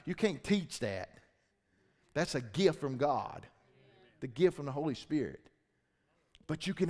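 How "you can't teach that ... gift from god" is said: ordinary delivery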